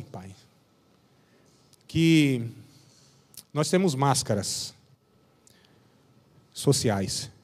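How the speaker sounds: slow, humble prayer with long pauses; lightly voiced pastor's humble prayer. Leads a closing prayer.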